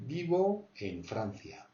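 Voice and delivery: slow, true Spanish accent on vivo en Francia; real Spanish accent. Shown as a demonstration. Teacher correcting French learners, slow genuine Spanish accent. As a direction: say it slowly like a native Spaniard. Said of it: "c'est vraiment l'accent espagnol".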